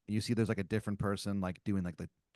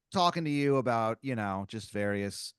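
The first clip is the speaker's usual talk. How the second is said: normal talking voice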